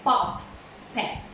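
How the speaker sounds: "pop, pet" said as two separate syllables; a clear break between "pop" and "pet"; split into syllables. Shown as a demonstration. Teacher breaking up "puppet" for the class, syllable by syllable. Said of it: "just like a".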